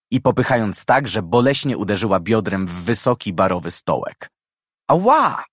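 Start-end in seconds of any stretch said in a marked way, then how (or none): 4.9-5.4 sharp high cry of pain. A narrator's reading. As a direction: yelp as if hit hard. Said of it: "krzyknęła z bólu".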